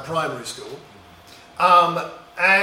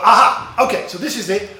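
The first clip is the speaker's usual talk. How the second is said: lively mock eureka, higher and louder; sudden realization; 5 semitones higher, 8 dB louder than usual. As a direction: act out a boy hitting on a brilliant plan. Retells his boyhood sudden realization.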